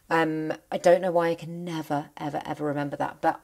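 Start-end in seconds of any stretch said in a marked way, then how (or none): none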